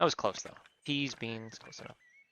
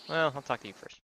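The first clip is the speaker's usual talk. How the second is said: normal talking voice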